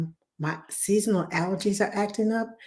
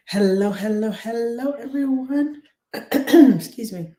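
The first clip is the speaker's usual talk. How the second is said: ordinary talk, nothing marked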